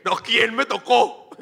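loud, slow, drawn-out demand; mock demand. Jesus demanding in the crowd who touched him, slow loud. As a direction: stretch it out loud and pointed.

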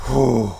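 heavy relieved whew; loud exhale. Real, not acted. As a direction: blow out a long breath. Exhale after intense point.

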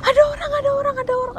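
very high, near-crying alarm; wailed warning, acted out at high pitch; retold panic. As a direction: re-enact a panicked cry of alarm. Re-enacts collapsing and raising the alarm.

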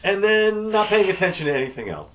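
high, loud teasing through laughter; laughing teasing. Real, not acted. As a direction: rib her while laughing. Teasing co-host while laughing.

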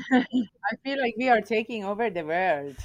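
put-on jokey Persian-accented English. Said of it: "when I do the Persian accent".

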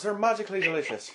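Lucky Charms leprechaun. Retold or imitated. Friend riffs on Irish jokes in a leprechaun ad voice. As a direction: sell the cereal like the cartoon leprechaun.

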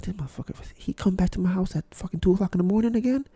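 annoyed muttering under breath. Retold or imitated. Annoyed in bed at 2am.

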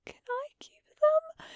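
tearing up. A narrator's reading. Chung Chung begging to keep the puppies, tearful whisper. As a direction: plead in a small whispered voice on the edge of tears. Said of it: "he starts tearing up".

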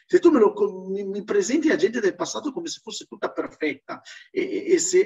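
usual speaking voice

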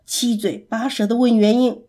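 ordinary delivery, nothing marked

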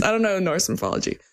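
ordinary delivery

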